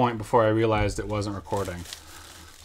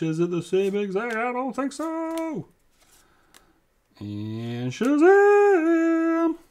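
high, slow, drawn-out hype; fake excitement; 13 semitones higher, 5 dB louder, much slower than usual. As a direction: ham up the surprise reveal. It's hard to fake excitement.

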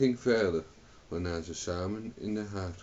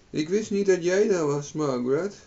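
high, loud astonishment; surprised; 10 semitones higher, 7 dB louder than usual. A narrator's reading. Owen discovers Margaret is there, surprised. Owen startled to find his wife.

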